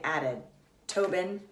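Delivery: ordinary delivery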